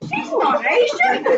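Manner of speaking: slow, high, loud, laughing; laughing protest. Retold or imitated.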